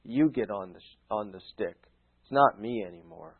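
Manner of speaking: low, soft, relaxed reply; gently teasing divine answer; God's voice. Retold or imitated. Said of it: "God's just looking back at you and saying".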